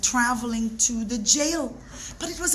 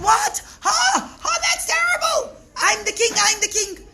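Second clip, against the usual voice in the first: much higher, loud furious king; angry outburst; 11 semitones higher, 5 dB louder than usual. Acted. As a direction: shriek your outrage at the throne. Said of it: "the king got so angry".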